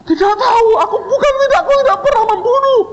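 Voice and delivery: very high, stumbling comic squeal; shrieky pretend plea of innocence; mock tearful panic. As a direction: gabble a denial in hammed-up terror.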